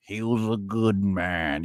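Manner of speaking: lightly mocked solemn tribute; mock eulogy. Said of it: "keep it from being like".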